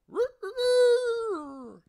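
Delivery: very high, very loud, slow drawn-out crowing; rooster crow. Acted. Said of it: "the rooster crowed".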